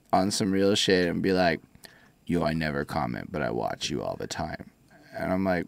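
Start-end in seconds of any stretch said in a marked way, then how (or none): 2.3-4.7 low, soft shy fan voice; lightly voiced lurker, lower and softer; mock fan. Imitating a fan who never comments.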